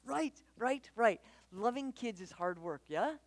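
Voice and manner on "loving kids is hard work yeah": ordinary talk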